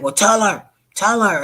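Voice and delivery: excited high call to tell her; loud urging. Retold or imitated. Friend egging him on, loud and high-pitched. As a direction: push him to speak up, loudly.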